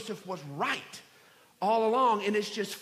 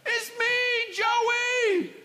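loud, high whooping gloat; gloating triumph; 7 dB louder, slower than usual. Acted. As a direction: crow over them in a drawn-out, hammed-up shout. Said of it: "could have had his moment and popped up".

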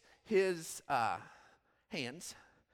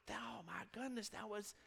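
softer, breathless amazement; awed hush; 8 dB quieter than usual. Retold or imitated. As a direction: whisper it in wonder. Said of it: "Then he was like".

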